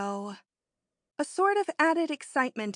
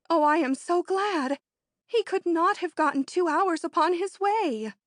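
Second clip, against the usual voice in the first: higher and louder, rushing with the news; excited; 6 semitones higher, 5 dB louder than usual. A narrator's reading. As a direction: gush it out, almost glad. Thrilled at the news of unrest.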